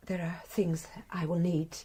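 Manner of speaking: soft unvoiced whisper; almost to herself, dazed; arrested wife asking quietly, in a whisper. Dazed after confession.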